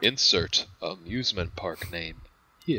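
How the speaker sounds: low flat placeholder voice; deadpan meme voice, dropped low; monotone voice. Acted. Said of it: "I'll just edit in a monotone voice right there".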